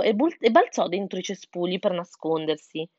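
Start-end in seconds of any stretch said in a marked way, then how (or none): none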